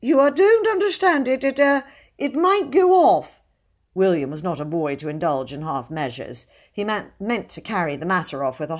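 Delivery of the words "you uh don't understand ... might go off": high, loud, faltering protest; alarmed captive in a high voice; plaintive pleading. A narrator's reading. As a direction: stammer out a frightened warning. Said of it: "pleaded his captive plaintively".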